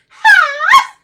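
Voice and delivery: very high, very loud call; piercing signal whoop, imitated; Darcy. Friend signalling where they are, imitated piercing high call. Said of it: "There's my friend Darcy".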